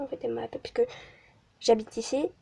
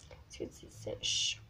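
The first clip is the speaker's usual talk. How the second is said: whispering; 16 dB quieter than usual. Real, not acted. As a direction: breathe it out softly. Whispered while drying. (chuchoté)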